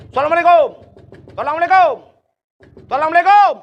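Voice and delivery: loud, high greeting called at the door; repeated loud greeting shouted through the door; loud calling greeting. Acted. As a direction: call out to wake the house. Knocking on a door at night.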